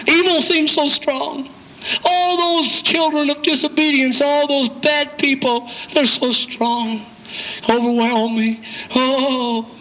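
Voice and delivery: high, slow, hammed-up whine; mock whiny defeat. Believer returning beaten, moaning that evil is too strong, high and whiny.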